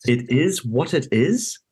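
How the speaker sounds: slow, disbelieving question; incredulous. Retold or imitated. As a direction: repeat it back in disbelief. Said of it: "And I was like".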